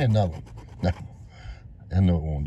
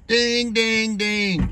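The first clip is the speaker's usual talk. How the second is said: excited ding ding; 7 semitones higher, much slower than usual. Real, not acted. Winning scratch-off sing-song.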